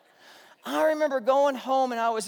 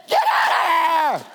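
loud, high, drawn-out yell; screaming; 7 semitones higher, 8 dB louder than usual. Retold or imitated. Pastor screaming at people going back to the table, full yell. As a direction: scream them off the picnic grounds. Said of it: "and I'm screaming".

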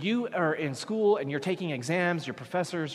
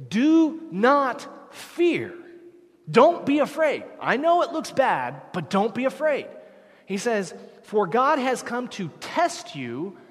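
loud, high, urgent sermon delivery; fiery preacher pressing the congregation; preaching at full force; 5 semitones higher, 4 dB louder than usual. Acted. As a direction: drive the point home to the congregation.

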